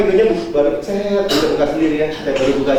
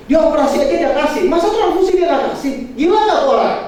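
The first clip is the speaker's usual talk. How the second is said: high, scandalized gossip; indignant sister-in-law; 10 semitones higher than usual. Retold or imitated. Sister-in-law complaining to the mother about her, scandalized and high. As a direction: sound outraged at her. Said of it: "adik ipar saya telepon ke mami".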